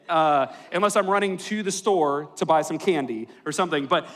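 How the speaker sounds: ordinary talk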